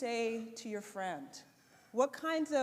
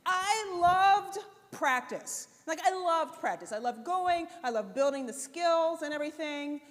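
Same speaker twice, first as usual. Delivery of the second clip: bright emphatic joy, stretching loved; gushing enthusiasm; 5 semitones higher, 6 dB louder than usual. Real, not acted. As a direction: lean into how much she loved it. Enthusing about basketball practice.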